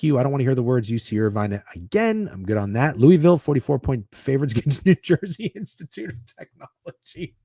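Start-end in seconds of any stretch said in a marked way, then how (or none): 4.5-7.5 laughing. Real, not acted. Laughing at an absurd matchup.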